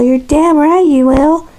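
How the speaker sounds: high-pitched mock indignant kid; put-on high, huffy kid voice; imitated kid. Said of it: "and then the kid, he's all".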